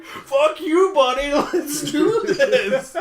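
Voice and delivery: high and loud, laughing through it; laughing Yoda. Retold or imitated. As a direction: laugh out the defiant line. Yoda's defiant line, laughing.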